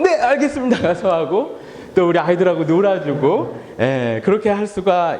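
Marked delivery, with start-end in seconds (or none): 0.0-0.8 higher, dutiful mock reply; acted eager obedience. Pastor acting himself obeying his wife, eager higher reply. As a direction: answer like a willing helper. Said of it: "저거 하세요".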